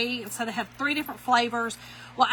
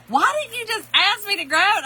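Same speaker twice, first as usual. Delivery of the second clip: higher, louder playful scold; husband teasing; 9 semitones higher, 7 dB louder, faster than usual. Retold or imitated. Husband asks why she didn't ask, a loud playful scold. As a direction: tease her for not asking.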